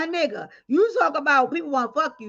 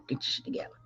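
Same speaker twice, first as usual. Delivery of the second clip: unvoiced annoyed whisper at the screen; whispered grumble; 13 dB quieter, much faster than usual. Real, not acted. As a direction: grumble it breathlessly. Muttering at slow computer.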